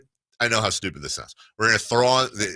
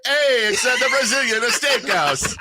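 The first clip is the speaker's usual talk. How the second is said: put-on Brazilian accent; 12 semitones higher, 4 dB louder than usual. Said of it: "Brazilian, I'd be like".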